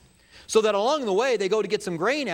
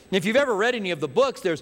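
ordinary talk, nothing marked

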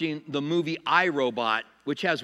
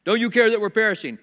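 louder, quicker pleading demand; bold challenge to God; 3 semitones higher, 6 dB louder, faster than usual. Acted. Peter challenging Jesus in the storm, bold urgent plea. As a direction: confront him with it, urgently. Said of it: "afraid to talk to God like that".

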